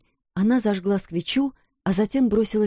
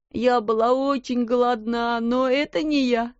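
sobbing; 5 semitones higher than usual. A narrator's reading. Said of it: "всхлипывая".

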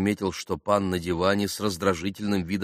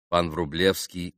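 ordinary delivery, nothing marked

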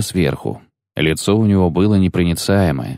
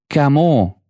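wincing question; 8 semitones higher than usual. A narrator's reading. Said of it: "поморщилась Веда".

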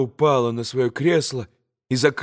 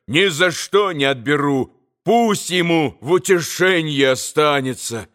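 plain reading voice

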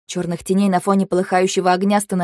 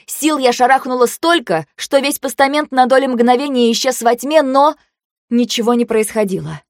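usual speaking voice

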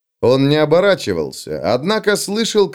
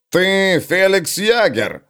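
slow, drawn-out dwarf greeting; gruff dwarf; 6 semitones higher, much slower than usual. Acted. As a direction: call him out slowly like a dwarf. (медленный голос гнома)

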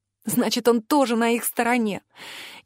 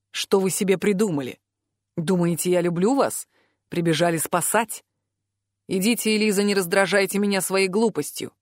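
low-voiced man, dismissive; cold, weary Alaris; 3 semitones lower than usual. A narrator's reading. Alaris denies loving Eliza, coldly dismissive. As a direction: push them away with cool indifference.

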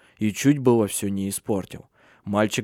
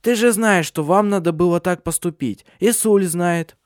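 high, loud, insistent crone; much higher scolding old-woman voice; 7 semitones higher, 5 dB louder than usual. A narrator's reading. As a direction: press the point as an old witch. Old Hanna lectures Tengel.

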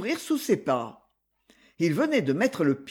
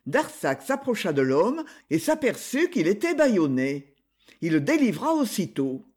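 usual speaking voice

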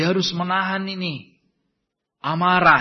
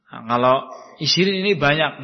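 usual speaking voice

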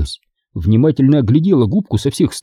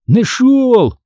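high, excited cry of discovery; breathless exclamation; 11 semitones higher than usual. A narrator's reading. Brother running up to his sister with a find, exclaiming. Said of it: "задыхаясь, воскликнул он".

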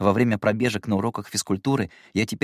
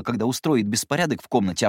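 ordinary delivery, nothing marked

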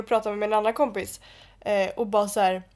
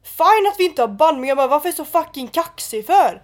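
higher, louder indignant retort; retold angry comeback; 5 semitones higher, 7 dB louder than usual. Retelling her row with a guy at the club, angry comeback. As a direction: fire back at a cocky guy, reenacted. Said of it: "då blir jag förbannad".

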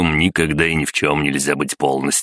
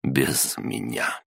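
low, slow, strained and soft; choked quiet; 4 semitones lower, 5 dB quieter, much slower than usual. A narrator's reading. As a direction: squeeze it out, barely holding on. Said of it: "сдавленно добавил".

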